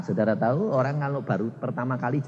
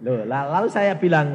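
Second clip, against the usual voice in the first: usual speaking voice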